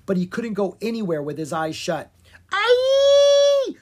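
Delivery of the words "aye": long, piercing high monster shriek; creature screech. A narrator's reading. Monster in the cave screeches at the boys. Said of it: "screeched the creature again".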